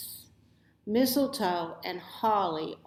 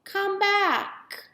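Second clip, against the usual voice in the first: yelling; 12 semitones higher, much slower than usual. A narrator's reading. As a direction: shout after it across the snow. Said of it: "so I yelled".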